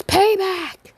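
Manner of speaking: loud, high shout of the stand's name; battle cry. Acted. Deku summons his stand to block, shouting high.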